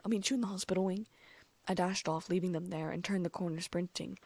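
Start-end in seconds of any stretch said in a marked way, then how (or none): 0.0-1.1 rushed and fast. Acted. Rushed exit.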